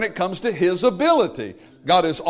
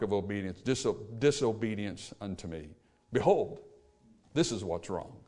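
solemn hush; 8 semitones lower, 6 dB quieter than usual. Real, not acted. Quiet summing up of verdict.

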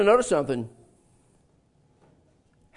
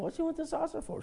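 puzzled thought; 10 semitones higher, 13 dB quieter, faster than usual. Retold or imitated. Inner thought, puzzled husband.

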